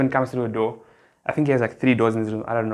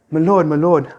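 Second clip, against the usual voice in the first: higher, louder frantic my lord; hammed-up servant alarm, pitched up; panicked servant cry; 4 semitones higher, 6 dB louder than usual. Servant bursts in on Job, calling out higher and louder in alarm. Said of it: "I'm just gonna say it like this".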